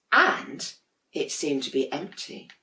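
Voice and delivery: ordinary delivery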